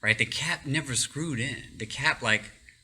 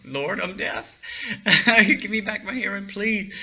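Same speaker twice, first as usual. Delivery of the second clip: higher, laughing plea to God; pleading prayer; 8 semitones higher, 4 dB louder than usual. Retold or imitated. Praying in the locked bathroom to get his hearing back, laughing plea. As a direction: beg God through a laugh. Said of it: "I am praying like you would never believe".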